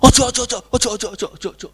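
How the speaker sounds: high rapid-fire repetition, fading away; mock panicked refusal. Child refusing mama's visit on the phone, mock panic.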